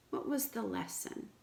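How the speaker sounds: usual speaking voice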